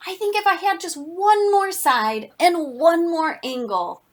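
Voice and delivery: lightly voiced character making a request; wishful quadrilateral. Quadrilateral pleads for another side.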